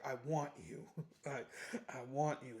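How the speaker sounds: low, soft come-on; hushed, low mock seduction; mock seductive. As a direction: murmur it low, imitating a seductress. She seduces him in closet.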